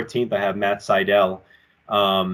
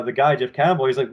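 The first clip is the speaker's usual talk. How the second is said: usual speaking voice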